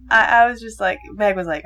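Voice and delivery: normal talking voice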